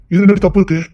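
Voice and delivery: loud, punching என்ன; loud hurt protest; indignant outburst. Acted. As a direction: demand it angrily. Heated defence in a quarrel.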